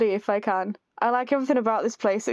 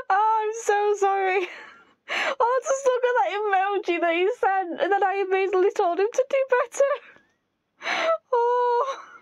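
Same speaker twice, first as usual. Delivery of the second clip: high, hammed-up fake sobbing through the words; mock crying; 9 semitones higher than usual. Player groaning over her cruel reply to Ray, mock sobbing. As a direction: wail it like a guilty mock cry.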